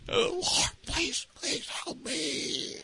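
slow, high whispered begging; desperate hushed plea. Retold or imitated. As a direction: beg God with hardly any voice. Acted desperate prayer.

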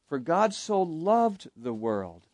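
"loved" held long, then falling away; slowly, with the weight on "loved"; stress on loved. Shown as a demonstration. Teacher demonstrating emphasis in a verse, stress on "loved". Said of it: "emphasize different words".

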